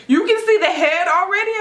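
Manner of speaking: high, loud retold disbelief; astonished question. Mother told the baby's hair shows, astonished. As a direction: act out amazed disbelief, pitch way up. Retells shock at hearing of hair.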